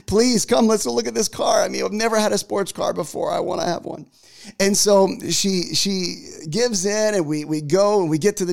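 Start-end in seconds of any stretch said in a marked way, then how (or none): 0.1-4.1 high-pitched, hammed-up pleading; begging. Husband begging wife to see the sports car, mock pleading. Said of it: "I'm begging my wife".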